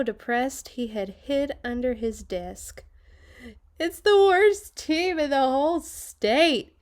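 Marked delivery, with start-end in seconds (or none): 3.8-6.8 high, loud wail of despair; despairing principal. Acted. Principal hiding under his desk, wailing about his team. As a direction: cry it out in hopeless misery. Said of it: "he said".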